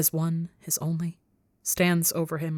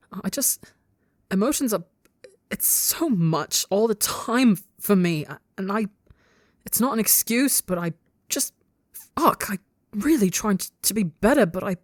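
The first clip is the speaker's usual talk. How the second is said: higher, halting, pleading explanation; on the verge of tears; 4 semitones higher than usual. A narrator's reading. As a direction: struggle to explain, breaking off in frustration. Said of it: "he's on the verge of tears".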